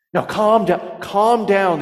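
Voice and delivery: usual speaking voice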